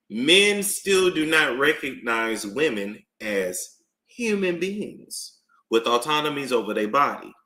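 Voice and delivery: usual speaking voice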